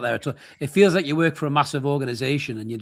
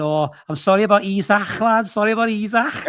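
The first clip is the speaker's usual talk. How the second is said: higher, louder Scouse voice, teasing; mock Liverpool accent; 7 semitones higher, 4 dB louder than usual. Liverpool stall worker teasing a Newcastle fan, in a Scouse accent. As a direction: play a cheeky Scouser rubbing it in. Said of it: "He went".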